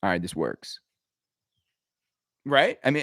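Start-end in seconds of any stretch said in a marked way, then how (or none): 0.0-0.8 low, soft mock shrug. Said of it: "and he's like".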